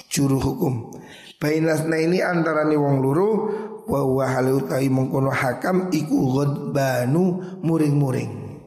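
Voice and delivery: usual speaking voice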